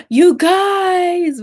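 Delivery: high, loud sudden burst; excited. Real, not acted.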